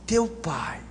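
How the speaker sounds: "Teu pai" low and nearly whispered; pointed, hushed TEU. Shown as a demonstration. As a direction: drop to a hush on "Teu pai", leaning on "Teu". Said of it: "Olha essa expressão".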